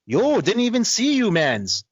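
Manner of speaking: high, slow mock-innocent "oh, didn't even see you"; mock surprised greeting. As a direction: play dumb in a high drawn-out voice. Friend's fake surprise, retold.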